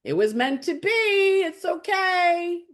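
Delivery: high, slow soothing voice; the eclipse speaking gently from on high. Retold or imitated. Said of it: "the eclipse, which says".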